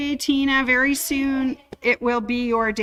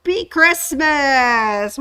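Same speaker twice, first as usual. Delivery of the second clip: festive cheer; 3 semitones higher, 4 dB louder, slower than usual. Real, not acted. Festive greeting shout.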